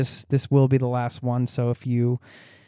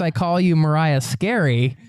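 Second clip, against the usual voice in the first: ordinary delivery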